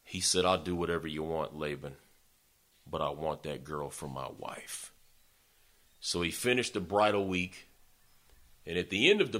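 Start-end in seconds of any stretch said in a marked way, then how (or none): 0.5-4.9 softer, lower, sincere promise; quietly devoted vow of a lovestruck man; earnest plea. Retold or imitated. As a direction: say it low, a man willing to pay any price. Jacob pleads his love for Rachel.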